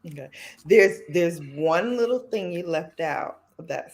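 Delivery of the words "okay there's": normal talking voice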